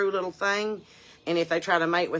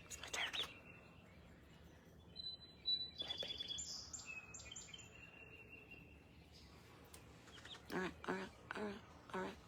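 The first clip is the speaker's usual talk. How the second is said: hushed cooing; 4 semitones higher, 23 dB quieter, faster than usual. Real, not acted. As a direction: coo to it gently. Cooing to the baby turkey.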